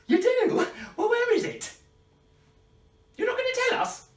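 high excited questions; excited surprise. Pretend. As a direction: gasp out each question eagerly.

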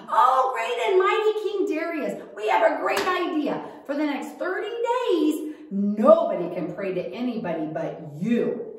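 high, loud fawning courtier voice; butter up the king, high and loud; flattering schemers. Acted.

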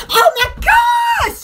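high, slow cry of amazement; drawn-out exclamation. Acted. Vlogger reacting to the camera, high drawn-out exclamation. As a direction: stretch out an amazed squeal.

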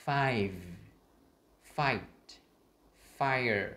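"Five, fight, fire" as separate words, each ending fully released; emphatic teacher's model, "Five, fight, fire" with distinct endings; clear final sounds. Shown as a demonstration. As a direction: drill "Five, fight, fire" slowly, finishing on v, t and r. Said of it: "khác nhau âm cuối nhé".

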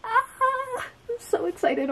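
high, teary thrilled cry; real joyful cry; emotional squeal. Real, not acted. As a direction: near tears with joy. Overwhelmed by gift from friend.

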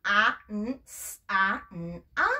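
"a, n, s, a" segmented, pitch sinking; letter sounds one at a time; sounded out. Shown as a demonstration. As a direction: spell out the sounds. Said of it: "Ella trajo una mm".

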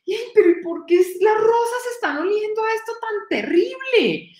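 high, whiny grandpa voice in disbelief; baffled grandpa. Acted. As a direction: play the old man completely puzzled by the roses. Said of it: "Y decía". (abuelito agudo y quejumbroso, incrédulo)

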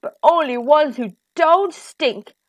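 loud giant laying down the law; big, emphatic giant voice; commanding giant. A narrator's reading. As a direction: boom the order, slowing hard at the end. Giant orders Jack to bathe.

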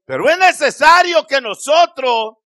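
usual speaking voice

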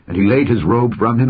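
plain reading voice

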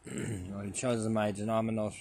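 ordinary talk, nothing marked

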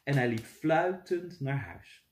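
plain reading voice

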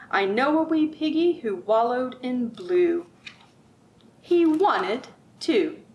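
sing-song picture book read-aloud; lilting, rhythmic storybook voice; reading to children. Acted. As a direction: read it like storytime for little ones.

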